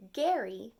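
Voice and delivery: usual speaking voice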